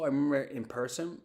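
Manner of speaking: ordinary talk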